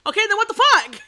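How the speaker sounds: high, loud what-the-hell cry; exasperated outburst. Retold or imitated. Retelling her reaction to friends, loud exasperated outburst. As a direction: blow up in disbelief.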